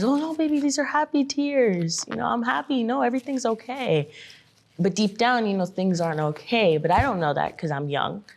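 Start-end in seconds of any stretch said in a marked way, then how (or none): 0.0-4.1 high-pitched, soothing mom through tears, imitated; reassuring mom. Daughter retelling mom hiding her tears, high, reassuring. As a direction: imitate a mother insisting she's fine. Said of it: "your mom crying and then she says".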